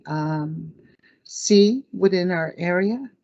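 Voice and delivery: normal talking voice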